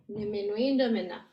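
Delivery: "niminwinda minna" falling at the end; no question rise. Shown as a demonstration. Teacher demonstrating an Ojibwe question, voice not going up. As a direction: ask it without lifting "minna". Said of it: "my voice didn't go up at the end".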